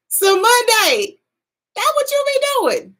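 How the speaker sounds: high, loud teasing voice; sassy mock accusation, high and loud; teasing call-out. Teasing the viewer's habit.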